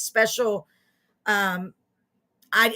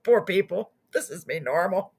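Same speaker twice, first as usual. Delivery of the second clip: ordinary talk, nothing marked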